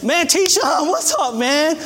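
eager shout-out to the friend who saved his family; excited greeting. Pretend. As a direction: call out to him overjoyed, voice way up. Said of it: "I'm gonna say".

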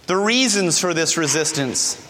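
ordinary talk, nothing marked

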